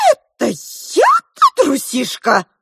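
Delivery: high, loud squeak of outrage; offended little mouse, slow and shrieky; indignant mouse. A narrator's reading. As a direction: stretch it out in piping indignation. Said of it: "разгневалась розовая мышь".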